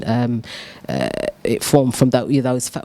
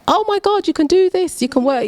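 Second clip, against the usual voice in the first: much higher, thrilled exclamation; delighted "oh my God" moment, pitched way up; excited realization. Retold or imitated. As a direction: gasp it out like a sudden discovery. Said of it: "And I was like".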